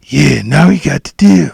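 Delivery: loud eerie taunting voice; creepy voice. Retold or imitated. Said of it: "That's the voice he would use".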